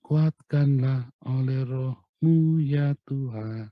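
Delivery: drawn-out, slow loud prayer; slow fervent prayer. Shown as a demonstration. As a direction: stretch each word as an earnest plea. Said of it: "Kita cuma bilang".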